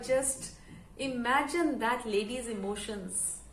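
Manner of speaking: ordinary delivery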